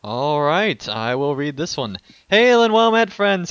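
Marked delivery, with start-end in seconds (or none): 2.3-3.5 loud, high hearty hail; booming greeting. Acted. Host greeting the podcast listeners, loud and high. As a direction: hail them like a tavern host. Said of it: "He stole it".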